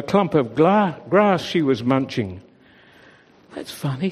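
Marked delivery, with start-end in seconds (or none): 3.5-4.1 thinking to herself. A narrator's reading. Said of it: "she thought".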